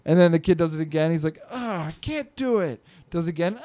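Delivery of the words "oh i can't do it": high, whiny child imitation; mock kid whine; whining kid. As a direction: whine it like a defeated little boy. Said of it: "Stop whining".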